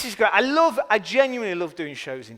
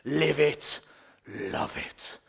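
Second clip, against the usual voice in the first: civic slogan; much slower than usual. Retold or imitated. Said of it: "It goes like this".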